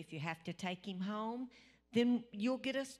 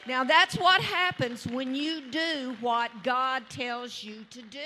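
slow, loud preacher's proclamation; triumphant shout; 6 semitones higher, 11 dB louder than usual. Real, not acted. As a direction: shout it out to the church.

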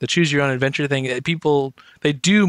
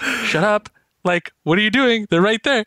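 exasperated hiss; 6 semitones higher than usual. Retold or imitated. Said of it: "And you're like".

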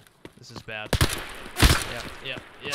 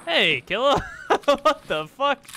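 laughing surprise; 10 semitones higher, 6 dB louder than usual. Real, not acted.

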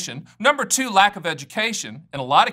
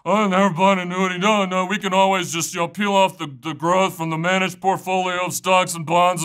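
mock smug broker. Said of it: "broker says".

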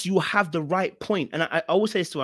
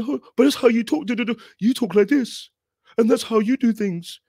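loud, high mock scolding; pushy put-on tirade; disrespectful rah-rah; 7 semitones higher, 5 dB louder than usual. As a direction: imitate an accusing rant at someone's face. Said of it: "When you start doing that, you start disrespecting".

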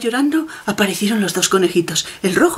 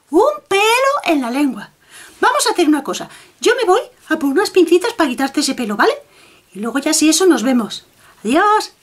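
much higher rabbit, "pelo" with a clear l; little rabbit voice; 7 semitones higher than usual. Acted. Rabbit correcting the wolf, "pelo" not "pedo", high voice. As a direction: correct him: "pelo", clean l, high and bright.

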